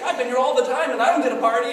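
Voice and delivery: high, loud whiny complaint; upset brother. Retold or imitated. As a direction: sulk like the left-out brother. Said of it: "the brother ends up being so upset".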